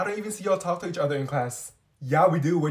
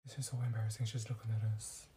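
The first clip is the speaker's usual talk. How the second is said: mortified hushed whisper; embarrassed whisper; 8 semitones lower, 16 dB quieter, faster than usual. Acted. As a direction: whisper it, cringing. Embarrassed, hiding from girl staring.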